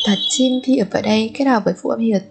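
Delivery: ordinary talk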